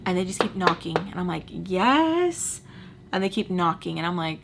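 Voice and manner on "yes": high, drawn-out questioning call; calling to the door. Retold or imitated. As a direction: call out to whoever is knocking, rising. Answering repeated knocking.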